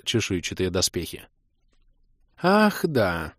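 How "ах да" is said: purring goddess, light and high; breathy vintage purr. A narrator's reading. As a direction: purr it out playfully. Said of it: "столь же ласково промурлыкала богиня".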